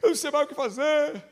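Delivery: hammed-up, end-of-the-world girlish wail; anguished teenage girl. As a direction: imitate a despairing teenage girl. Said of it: "mas angustiada, angustiada".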